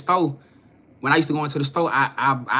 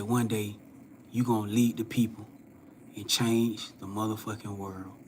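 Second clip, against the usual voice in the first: solemn prophecy; 3 semitones lower, 6 dB quieter than usual. Retold or imitated. The old man quietly telling the young man God chose him.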